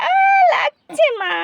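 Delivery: put-on high, tearful little girl; little girl crying to her mother; hurt crying child. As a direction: cry out that it hurts.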